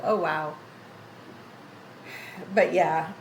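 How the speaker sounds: ordinary talk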